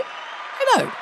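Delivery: tiny high squeaky voice; squeaky Bob voice. Acted. Sidekick Bob agrees with the host, squeaky.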